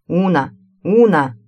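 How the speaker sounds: loud call into the dark. A narrator's reading. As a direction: call her name across the corridor. Calling out in dark corridor.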